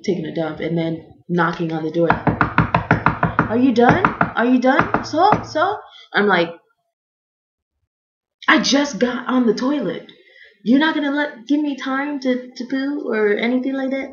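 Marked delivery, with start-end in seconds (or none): 2.1-5.8 high, drawn-out questions rising at the end; mimicked impatient nagging, climbing in pitch; imitated pestering. Impatient staff knocking on toilet door. 8.5-14.1 high-pitched, indignant retort; replayed exasperation at being rushed; acted exasperated protest. Protesting back through the toilet door.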